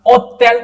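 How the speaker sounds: "ओतेल" pressed out, without an H; teacher modelling French "ओतेल"; stretched, pressed vowel. Shown as a demonstration. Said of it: "एकदम बिल्कुल खींच दबा के sound देते हैं".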